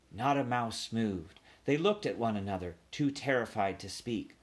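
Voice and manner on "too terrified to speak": usual speaking voice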